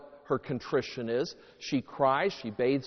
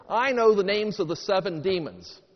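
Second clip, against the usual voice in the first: loud, higher-pitched proud announcement; eager pupil; 5 semitones higher, 6 dB louder than usual. Acted. Pupil answering the religion teacher, eagerly announcing.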